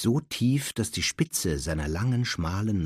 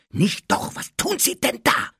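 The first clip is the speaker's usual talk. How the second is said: hissed, raised-pitch protest; urgent hiss; 6 dB louder than usual. A narrator's reading. Harry stopping the elf hurting himself, urgent hiss. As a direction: hiss it sharply, pitch climbing in alarm. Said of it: "zischte Harry".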